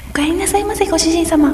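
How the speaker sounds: high, sweet maid voice; sugary maid cafe greeting. Acted. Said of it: "うわ、気持ち悪い。".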